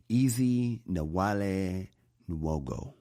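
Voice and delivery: slow, halting, spaced-out syllables; careful name attempt. Real, not acted. Podcaster attempting a Nigerian socialite's name, careful and halting. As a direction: feel your way through an unfamiliar name. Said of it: "I'm gonna mess his name up".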